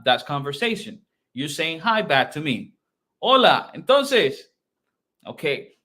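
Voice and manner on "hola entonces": high, loud acted hello; animated greeting. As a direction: call out a lively greeting to someone met on the street. Acts out greeting on the street. (lively, higher-pitched greeting exchange)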